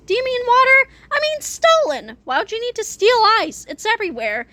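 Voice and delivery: high-pitched cartoon bickering; high, comic back-and-forth voice; puzzled cartoon characters. A narrator's reading. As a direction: squeak it out, puzzled and exasperated. Confused exchange between scheming characters.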